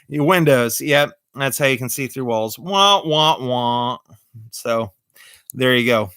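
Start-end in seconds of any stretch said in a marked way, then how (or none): 2.6-4.0 mock sad trombone. Sad trombone after bad joke.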